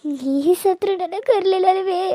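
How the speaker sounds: high, tearful wailing over the dead mother; ಅಳುತ್ತಾ ಗೋಳಾಡುವುದು; crying lament. Acted. Daughter wailing over mother's death.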